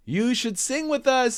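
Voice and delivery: sing-song birds inviting; bird chorus. Acted. Birds inviting Jim, high and chirpy. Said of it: "said the birds".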